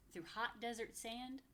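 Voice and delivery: plain reading voice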